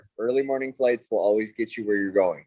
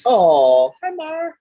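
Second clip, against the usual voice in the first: talking to a dog; 13 semitones higher, 7 dB louder, much slower than usual. Real, not acted. Greeting the dog.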